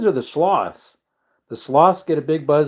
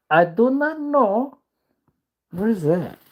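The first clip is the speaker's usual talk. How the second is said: silly voice; 10 semitones higher, slower than usual. Pretend. Silly drawn-out voice.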